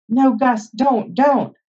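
high, urgent protest; alarmed pleading. Acted. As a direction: cry out to stop your brother. Frightened boy stopping his brother.

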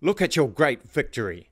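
shouted. A narrator's reading. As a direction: bark the order in fury. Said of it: "shouted the king".

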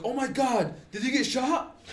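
shocked, high question down the phone; alarmed sister. Retold or imitated.

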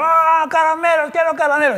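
put-on very high, loud wailing child tantrum; imitated shrieking tantrum; child's tantrum scream. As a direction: shriek it like a kid throwing a fit. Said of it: "y a gritar".

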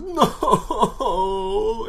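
high, loud drawn-out "no"; mock crying wail. Rick sees Carl and learns Laurie died, imitated wail. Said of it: "And he says, "Cry".

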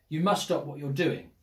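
ordinary delivery, nothing marked

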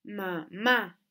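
"mamá" with a firm MÁ; stress on second syllable. Shown as a demonstration. Teacher giving an aguda example, "mamá" stressed on MÁ. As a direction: lean on the final MÁ of "mamá". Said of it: "lleva tilde en la segunda sílaba".

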